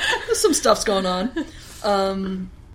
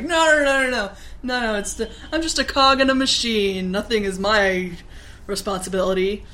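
defensive protest; 2 semitones higher, 4 dB louder than usual. Retold or imitated. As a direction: protest your innocence, loudly. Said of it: "he's like".

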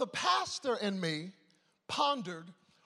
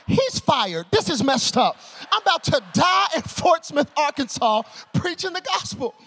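loud, higher-pitched comic alarm; mock panic; 6 semitones higher, 7 dB louder than usual. Preacher thinking he will die preaching, loud mock panic. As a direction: play up the panic for the crowd. Acting out his panicked thoughts.

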